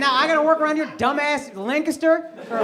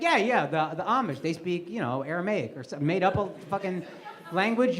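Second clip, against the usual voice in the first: mock confident; 7 semitones lower, 7 dB quieter than usual. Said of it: "if I just confidently was like".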